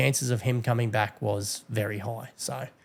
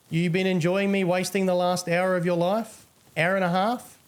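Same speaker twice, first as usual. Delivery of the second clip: much higher, sugary fake-friendly voice; mocking taunt; 7 semitones higher than usual. Real, not acted. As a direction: needle him with syrupy sweetness. Taunting the banned player in-game.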